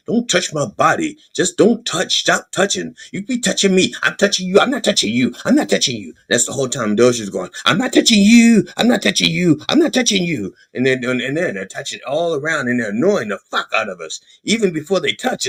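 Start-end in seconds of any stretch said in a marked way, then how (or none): 0.1-6.1 put-on higher-pitched bickering kids; mock petulant back-and-forth; childish squabble. Acting out a childish touching squabble. 7.7-10.5 high, needling playground taunt; mock-innocent goading, pitched up; childish taunt. Mocking Doge as a taunting child.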